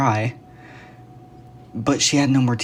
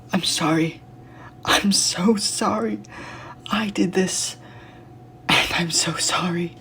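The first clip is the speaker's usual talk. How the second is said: tearful whisper; 7 semitones higher, 4 dB quieter than usual. Acted. Grieving over dead Garen.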